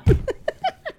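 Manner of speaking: squeaky high giggle; a burst of high laughter at his own joke; high-pitched laugh. Real, not acted. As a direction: let out a high, rising laugh. Laughs at his own Oregon bet.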